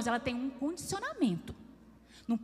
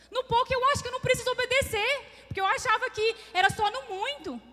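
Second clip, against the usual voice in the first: higher, louder, pleading protest; flustered protest; 9 semitones higher, 5 dB louder than usual. Retold or imitated. Wife protesting to her husband that she isn't faithful, higher and pleading. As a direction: blurt it out, embarrassed and high.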